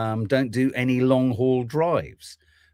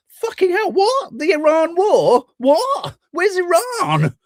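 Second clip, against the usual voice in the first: much higher, louder squawking outrage; clueless Brit outburst; 6 dB louder, slower than usual. Retold or imitated. Host mocking an oblivious Brit who has never heard of Iran, shrill hammed-up outrage. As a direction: shriek like a baffled Brit just hearing of war.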